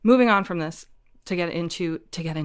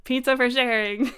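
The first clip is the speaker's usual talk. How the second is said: giggling the words back, pitched up; laughing; 6 semitones higher than usual. Real, not acted. Guest echoing the host's pun, laughing. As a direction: laugh through the repeated joke.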